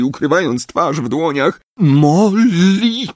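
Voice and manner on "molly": gentle call. Acted. Lupin calls to weeping Molly.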